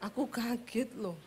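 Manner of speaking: very soft, calm report of being startled; mock calm Javanese husband, very soft; soft Solo husband. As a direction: say you're startled almost under your breath. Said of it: "Orang Soloi kaget laporan".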